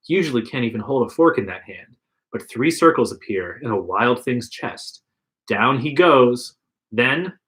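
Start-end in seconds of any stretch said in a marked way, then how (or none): none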